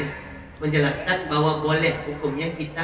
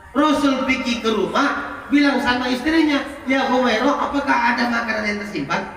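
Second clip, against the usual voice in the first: higher, louder storytelling; lively preaching; 8 semitones higher, 4 dB louder than usual. Acted. Preacher telling the Prophet's visit, raised. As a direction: lift the voice into the story.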